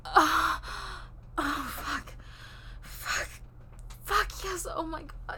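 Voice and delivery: loud gasping cries of pleasure; passionate cries. Acted. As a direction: cry out, overwhelmed. Peak of intimate scene.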